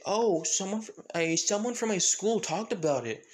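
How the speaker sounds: mildly acted kid; Eri. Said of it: "with Eri saying".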